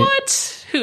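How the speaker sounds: squeaky fake-innocent What; mock innocent surprise. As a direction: act caught but play dumb. Feigning innocence about the donuts.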